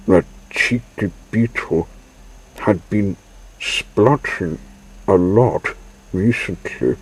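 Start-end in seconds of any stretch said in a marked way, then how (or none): none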